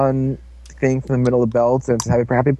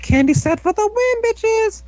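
very high-pitched mock victory cry; high silly cheer. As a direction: squeak it out, held high on one note. Joke cheer over the clip.

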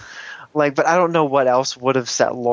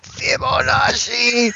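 slow, higher, stretched-out calling of the name; mock villain cry; 11 semitones higher, much slower than usual. A fan mocking a villain's obsessive calling. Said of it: "he's like".

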